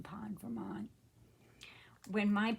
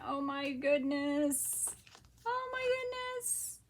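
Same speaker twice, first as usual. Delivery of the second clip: high, drawn out, climbing to a squeal; marvel, then squeal it higher; delighted amazement; 7 semitones higher, 4 dB louder than usual. Real, not acted. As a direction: overcome with delight, pitch soaring. Delighted by gift.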